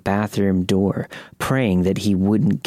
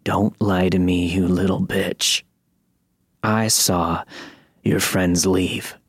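gravelly voice; slower than usual. A narrator's reading. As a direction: growl it slowly like a thug. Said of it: "that gravelly voice said".

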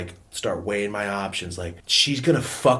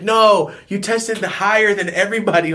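loud, raised-pitch insistence; emphatic correction; 8 semitones higher, 6 dB louder than usual. Retold or imitated. School correcting the kid's mistake, emphatic correction. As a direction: push it out high and forceful.

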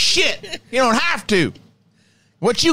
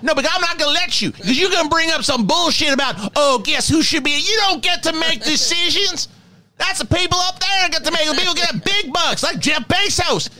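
higher, ranting troll voice, insulting the fan; Professor Crow rant; 4 semitones higher than usual. Pretend. Professor Crow berating a Star Wars fan, higher ranting voice. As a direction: tear into him like a mean cranky professor. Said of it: "Professor Crow needs its own Patreon".

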